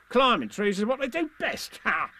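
high, swaggering brag; boastful Tigger. Acted. Tigger brags to Roo about climbing, high swagger. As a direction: crow it with a closing "ha!". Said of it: "said Tigger".

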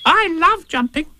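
tiny, very high squeaky youngster; high-pitched eager little voice; Roo's voice. Acted. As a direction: squeak it slowly with childlike relish. Roo chats with Tigger.

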